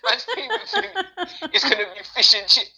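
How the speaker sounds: high, breaking into laughter while talking; laughing. Real, not acted. Man mocking the fish and chips assumption, laughing. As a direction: laugh through it, pitch climbing.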